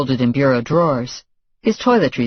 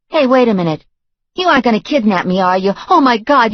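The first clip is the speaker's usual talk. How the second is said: high, loud and quick; alarmed girlfriend; 9 semitones higher, 5 dB louder, faster than usual. A narrator's reading. Girlfriend suspecting a kidnapping, high and alarmed. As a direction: panic starting to rise.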